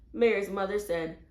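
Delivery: plain reading voice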